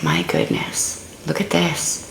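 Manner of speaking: hushed, awed whisper. Real, not acted. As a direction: breathe it out in wonder. Awed at a drawn card.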